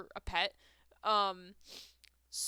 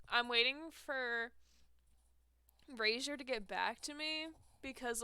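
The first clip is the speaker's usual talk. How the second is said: ordinary talk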